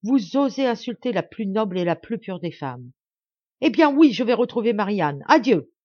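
a husband in a rage shouting at his wife; beside himself. A narrator's reading. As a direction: hurl it back at her. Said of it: "Hors de soi".

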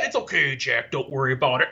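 low wrestler's reassurance; Jesse the Body. Retold or imitated. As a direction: imitate a big wrestler, deep and easy. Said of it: "that's what he told my dad".

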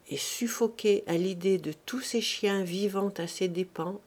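plain reading voice

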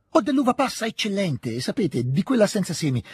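put-on high, eager young voice; boyish enthusiasm. A narrator's reading. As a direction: rush to share a treat, pitched high. Ragazzo entusiasta offre l'uva passa. (entusiasmo infantile, voce acuta)

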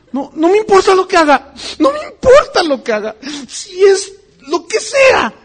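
high, loud tearful wailing of a zealot; crying devoted fan. Pretend. Imitated churchgoer defending a beloved preacher, wailing in tears. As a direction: sob out your blind devotion at full voice.

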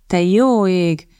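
slow, groaning sigh of words; a put-upon groan at yet another fuss; weary groan. Acted. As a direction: drag it out like a tired groan. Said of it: "nyögte Radcliffe".

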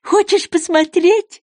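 high, slow, eager question; hopeful. A narrator's reading. Goby offers to show Alice the dance, hopeful. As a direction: ask it high, longing for a yes. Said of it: "с надеждой спросил бычок".

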